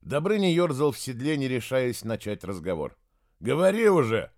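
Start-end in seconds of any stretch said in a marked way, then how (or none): 3.4-4.3 loud, high, impatient snap; angry. A narrator's reading. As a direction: snap it out in anger. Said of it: "разозлился Святослав".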